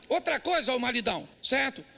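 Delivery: usual speaking voice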